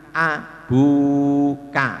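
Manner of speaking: "abuka" very slow, only the bu stretched; bu panjang. Shown as a demonstration. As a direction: hold just the BU of "abuka" for two beats. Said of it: "bunya saja dua harokat". ("abuka" dengan bu saja dipanjangkan)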